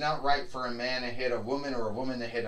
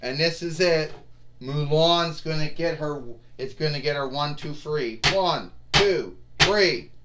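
louder, higher pin count; excited play-by-play; 3 semitones higher, 4 dB louder than usual. Pretend. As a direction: count the pin like a ringside announcer. Ringside commentary counts the pin.

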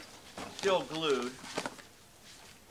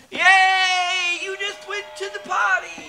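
excited cheer; 10 semitones higher, 7 dB louder, slower than usual. Pretend. Cheering a toddler on potty.

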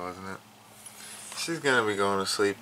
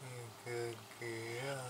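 slow, much softer cooing to the cat; 14 dB quieter, slower than usual. Real, not acted. To her sleepy cat, soft slow cooing. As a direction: coo gently to a sleepy pet. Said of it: "in that light voice".

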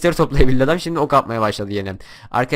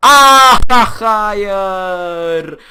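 loud, high cry of defeat; despairing shout; 7 semitones higher, 8 dB louder, much slower than usual. Real, not acted. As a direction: yell as it all goes wrong. Falls and loses.

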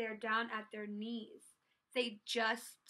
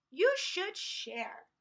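higher, louder, slow admonishment; scolding; 6 semitones higher, 6 dB louder, slower than usual. A narrator's reading. Triceratops to the toy snatcher, scolding. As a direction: tell them off slowly and emphatically.

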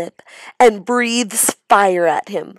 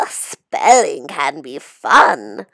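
beaming; 5 semitones lower, 6 dB louder, slower than usual. Acted. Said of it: "beams Missus Green".

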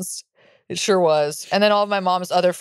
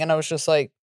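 ordinary talk, nothing marked

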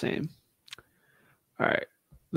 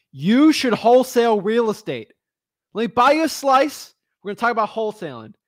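loud, higher-pitched pizza-shop pitchman; guy in the back; 8 semitones higher, 5 dB louder than usual. Acted. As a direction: play an eager back-room recruiter. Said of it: "I'm gonna be the guy".

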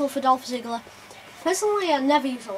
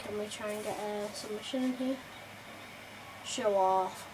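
ordinary delivery

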